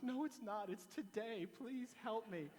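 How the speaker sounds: insisting the exterminator come today, mock desperation; mock desperate insistence. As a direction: refuse to wait, pleading.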